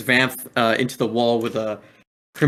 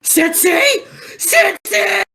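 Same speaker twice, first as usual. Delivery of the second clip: anguished high scream; shouting Sensei; 7 dB louder, much slower than usual. Acted. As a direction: cry out for your fallen teacher at full force. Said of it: "And Woodchuck shouts".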